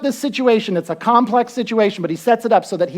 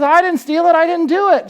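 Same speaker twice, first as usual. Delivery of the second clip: pleading denial; 8 semitones higher, 4 dB louder than usual. Retold or imitated. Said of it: "And he goes".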